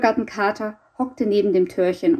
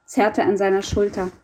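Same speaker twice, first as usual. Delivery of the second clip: usual speaking voice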